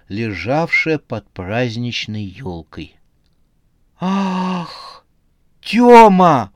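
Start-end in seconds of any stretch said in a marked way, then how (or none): 4.0-6.5 high, loud cry of a frightened wife; alarmed exclamation. A narrator's reading. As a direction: call out her husband's name in alarm. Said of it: "воскликнула молодая женщина".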